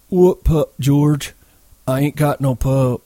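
put-on high, childlike character voice; simple-minded fellow feigning ignorance; innocent denial. A narrator's reading. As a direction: deny it innocently, pitched well up. Lenny denies having the pup.

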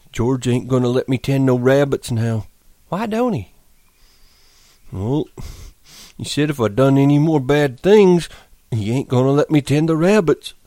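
a big simple man mourning his pup; Lenny, grieving. Acted. Lenny talks sadly to the dead pup.

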